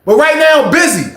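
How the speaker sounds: high, loud dare to the room; shouting a challenge to the whole cafeteria; high challenging shout. Retold or imitated. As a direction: square up to everyone. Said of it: "I said".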